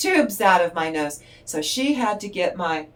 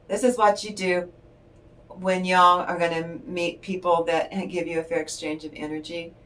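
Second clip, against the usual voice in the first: usual speaking voice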